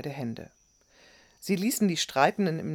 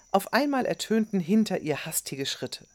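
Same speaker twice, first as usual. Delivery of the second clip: ordinary delivery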